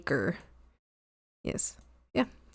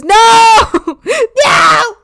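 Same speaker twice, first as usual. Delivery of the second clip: high, loud laughing cry; laughing shout; 14 dB louder than usual. Real, not acted. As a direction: scream no in laughing despair. Reacting to eight more hours.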